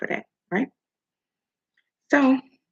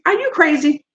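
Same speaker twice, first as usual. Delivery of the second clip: loud, higher outraged question; indignant; 6 semitones higher, 6 dB louder than usual. Real, not acted. Scolding the host over our [name], indignant. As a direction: snap out of song into scolding.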